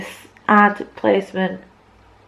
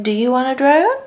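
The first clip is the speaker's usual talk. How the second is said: mock advert; 5 semitones higher than usual. Vlogger voicing the ironic drone ad, mock sales pitch. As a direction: pitch climbing into a cheesy sales question. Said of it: "That's basically saying".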